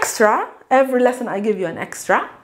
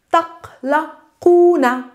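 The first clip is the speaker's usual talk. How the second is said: slow model word; 5 semitones higher, slower than usual. Shown as a demonstration. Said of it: "you worry, all of you".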